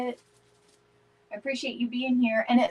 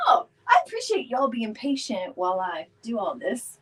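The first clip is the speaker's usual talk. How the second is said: ordinary delivery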